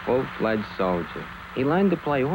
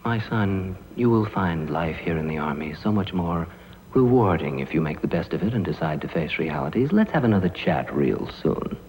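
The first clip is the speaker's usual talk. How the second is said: low, sermonizing chaplain voice; army chaplain; 9 semitones lower than usual. Acted. Chaplain advises the boy to face realities, low pastoral voice. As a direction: counsel him in a deep pastoral tone. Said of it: "He went to the chaplain".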